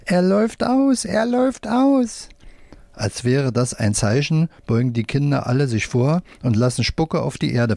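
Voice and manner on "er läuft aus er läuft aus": very high, squeaky puppet alarm; alarmed hand puppet. A narrator's reading. Left hand thinks the boy is leaking, high alarmed puppet voice. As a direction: squeal it like a little puppet in a flap. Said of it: "Wolfram mit der linken Hand".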